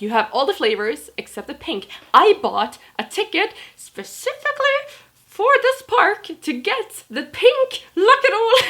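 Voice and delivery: high and loud hammed-up whine, slowing at the end with a laugh; terrible acting angry customer. Customer demands a refund for his candy, high, loud and hammed-up. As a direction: play the furious man badly on purpose. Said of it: "my acting is terrible".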